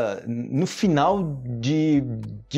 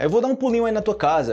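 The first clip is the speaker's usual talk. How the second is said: higher, bright casual quote; quoted friend; 9 semitones higher, faster than usual. Retold or imitated. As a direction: toss it off like a friend. Quoting a Brazilian's phrase. (imitando um brasileiro, voz mais aguda)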